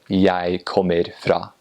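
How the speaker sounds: slow Eastern Norwegian, "Jeg" as "yai", full "kommer"; Eastern Norwegian dialect. Shown as a demonstration. Contrasting with the Northern form, slow Eastern Norwegian dialect. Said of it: "instead of".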